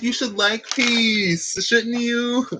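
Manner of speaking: high, slow teasing question. Retold or imitated. Teasing her Libra boyfriend in a high, slow voice. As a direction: tease him sweetly, slowly. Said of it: "I'm like".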